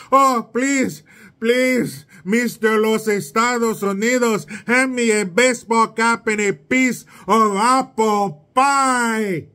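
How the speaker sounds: a hint of an African accent mocking a migrant. Mocking an imagined migrant, lightly put-on African accent. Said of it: "why'd you do an African accent".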